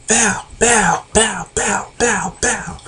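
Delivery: high, punchy "bow" sounds; imitated punch noises. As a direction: act out a rain of punches with your voice.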